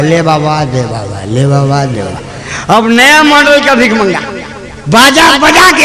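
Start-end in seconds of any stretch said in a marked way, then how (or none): 0.0-2.8 low, subdued beggar's plea; old-time beggar. Acted. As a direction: plead humbly for alms in a low murmur. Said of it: "पहले लोग भीख मांगते थे". (भिखारी जैसी धीमी, दबी पुकार)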